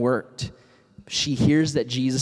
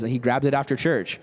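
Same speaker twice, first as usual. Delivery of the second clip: usual speaking voice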